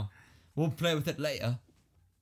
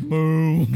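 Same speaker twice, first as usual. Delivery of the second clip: loud drawn-out moo; mock moo; 11 dB louder than usual. Billy Bob answering as a cow, mooing. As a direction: bellow like a cow. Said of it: "And Billy Bob said".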